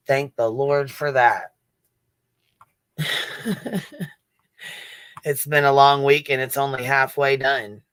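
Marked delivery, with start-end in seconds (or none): 3.0-4.1 high laugh. Real, not acted.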